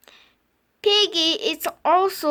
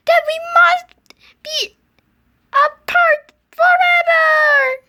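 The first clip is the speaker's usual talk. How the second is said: melodramatic despair; 10 semitones higher, 8 dB louder than usual. Acted. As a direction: ham up the tragic parting. Melodramatic parting.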